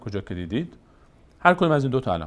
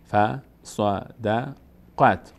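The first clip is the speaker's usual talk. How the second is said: «فصدقت» very slowly split as fa, sa, da, qat; syllable-by-syllable reading; much slower than usual. Shown as a demonstration. Teacher breaking the word down, syllable by syllable. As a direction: separate each syllable of فصدقت with gaps. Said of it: "قاف میچسبه به".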